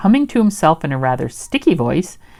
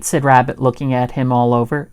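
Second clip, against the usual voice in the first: ordinary delivery, nothing marked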